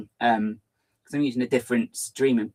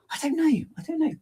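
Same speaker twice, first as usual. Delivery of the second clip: high helpless singsong. Real, not acted.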